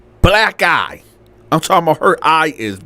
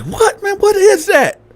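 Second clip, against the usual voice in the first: very high-pitched baffled question; jolted-awake comic bewilderment; mock disbelief. Landlord woken by an argument outside, baffled.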